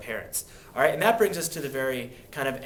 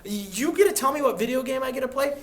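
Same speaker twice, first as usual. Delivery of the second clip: put-on outraged teenage squawk, much higher and louder; indignant teen; 10 semitones higher, 5 dB louder than usual. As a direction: protest like a fed-up kid. Teen protesting to parents.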